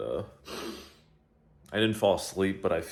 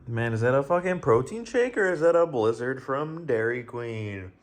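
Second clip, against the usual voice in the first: higher, louder exclamation of amazement; mock amazement; 4 semitones higher, 5 dB louder than usual. Real, not acted. Vlogger marvelling at a very thick protein shake, amazed. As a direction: marvel loudly at it, pitched up.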